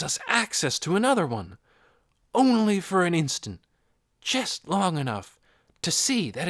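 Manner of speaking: a hint of an excited old scientist sharing secrets; mildly voiced eager old astronomer; eccentric old astronomer. A narrator's reading. Hoyt describes a rift.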